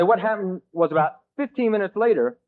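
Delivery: usual speaking voice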